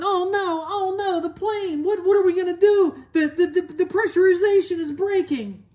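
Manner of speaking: high-pitched, stammering panic; frantic high mock yell; panicked pilot yelling. A narrator's reading. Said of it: "the pilot was yelling".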